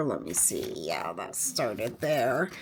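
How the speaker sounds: very low, hushed aside; talking to oneself. Real, not acted. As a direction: drop right down to a soft self-check. Aside to herself while working.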